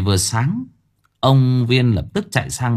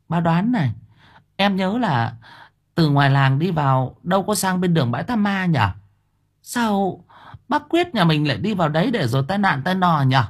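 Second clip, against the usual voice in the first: neighbour woman's voice; 7 semitones higher than usual. A narrator's reading. Neighbour women voiced higher.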